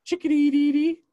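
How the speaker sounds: high loud chickadee song; chickadee call. Shown as a demonstration. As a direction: chirp it like a chickadee. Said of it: "A lot of people know the chickadee sound".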